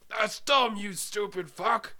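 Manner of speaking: high, drawn-out insult; hammed-up contemptuous father; berating dad. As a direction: dismiss the son's idea with mock fury. Acted dad berating his son.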